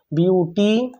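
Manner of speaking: Beautiful stretched out, with a long stop before फुल; beautiful in separate, lengthened beats; broken into syllables. Shown as a demonstration. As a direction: draw out beauty, pause, then say फुल. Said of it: "एक बार से ज़्यादा हम use करेंगे".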